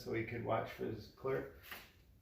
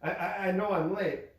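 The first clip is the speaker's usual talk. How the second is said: higher and louder, tripping on I; stammering fear; 6 semitones higher, 5 dB louder than usual. A narrator's reading. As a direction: clerk stammers to his stern boss, frightened. Frightened clerk stammers.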